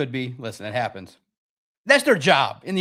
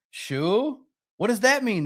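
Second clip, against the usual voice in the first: mock innocent; 6 semitones higher, slower than usual. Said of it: "repeats his mock reply".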